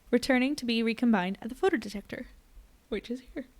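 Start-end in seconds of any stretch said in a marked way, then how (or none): 2.9-3.5 words stretched by a yawn. Real, not acted. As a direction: say it mid-yawn. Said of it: "Had to yawn there".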